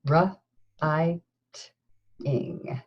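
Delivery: R, I, T, ing, each sound split apart; sounding out words. Shown as a demonstration. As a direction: segment writing into R, I, T, ing. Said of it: "We could just do ing".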